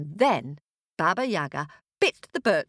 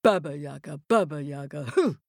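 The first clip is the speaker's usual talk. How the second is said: low rhythmic chant with rising hoots; witch in flight; 3 semitones lower than usual. A narrator's reading. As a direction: chant the name, then hoot like rushing wind. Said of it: "went Baba Yaga as she swished".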